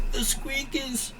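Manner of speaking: high, slow, strained dying warning; dying croak. Acted. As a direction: croak it out as you collapse.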